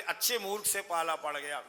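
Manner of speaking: ordinary delivery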